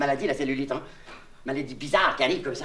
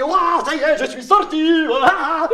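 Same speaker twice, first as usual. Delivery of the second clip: high, very loud whoop of freedom; acted joyful shout; 11 semitones higher, 10 dB louder, slower than usual. Prisoner let out into the yard, shouting with joy. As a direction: cry out, elated to be out. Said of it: "tu te mets à crier".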